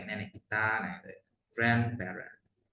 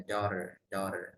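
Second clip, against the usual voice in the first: American "daughter", final r still heard; American pronunciation; 4 semitones lower, 4 dB quieter than usual. Shown as a demonstration. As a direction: keep the r, American-style "daughter". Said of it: "kalau American tuh R-nya masih". ("daughter" logat Amerika, R terdengar)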